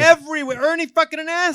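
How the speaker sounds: high, loud exasperated rant; mock outrage. Host rants about a newsman's headshot in every diner, mock outrage. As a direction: blow up at the rival's name, loud and high.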